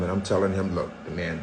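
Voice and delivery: ordinary delivery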